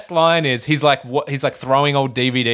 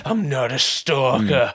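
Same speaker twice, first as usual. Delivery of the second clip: lightly voiced slow insistence; slow insistent denial; slower than usual. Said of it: "he's just like".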